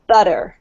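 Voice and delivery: butter said American-style, BUDDER; D sound for T. Shown as a demonstration. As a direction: soften the T in butter to a quick D. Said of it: "pronounced like a D sound".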